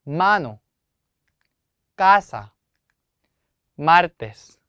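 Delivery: 마노, 까사, 마르테스 each with a strong first vowel; stress on second-last syllable. Shown as a demonstration. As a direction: punch the first vowel of 마노, 까사 and the 마 of 마르테스, pausing between words. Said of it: "항상 모음에 강세가 들어갑니다".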